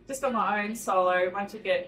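usual speaking voice

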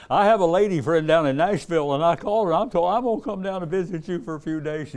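high, loud retelling of a widow's troubles; voicing the grieving widow. Preacher retelling a new widow's woes, high and loud. Woman telling her plan.

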